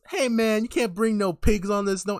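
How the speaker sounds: hammed-up indignant driver; objecting bus driver. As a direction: play a driver refusing a passenger's pet.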